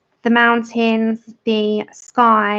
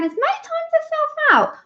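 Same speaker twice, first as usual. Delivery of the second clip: mock surprised. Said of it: "I'm looking over at the computer saying".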